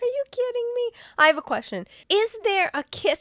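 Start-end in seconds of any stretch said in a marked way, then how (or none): 0.0-0.9 very high-pitched disbelief; incredulous squeal. Real, not acted. Co-host reacting to the movie recap, incredulous high squeal. Mock outrage at co-host's plot recap.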